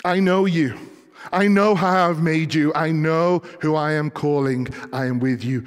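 God speaking, loud and slow, then easing; impassioned preaching. Acted. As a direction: declare it with ringing authority, settling at the end.

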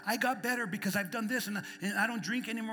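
loud, high, impassioned preaching; preaching at full force. Acted. Preacher urging the congregation to pray, impassioned. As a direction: drive it home from the pulpit.

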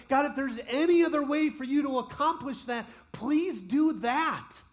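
loud, high plea slowing right down on please do that; beg, then draw out the last words; pleading in the garden. Acted. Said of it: "for him to say".

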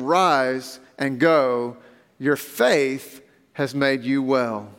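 slow, solemn reading of Jesus' words; scripture reading. Acted. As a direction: let each question land slowly.

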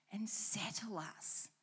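slow whispered close; hushed whisper ending the promise. Real, not acted. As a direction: breathe the words out softly. Closing a list of promises.

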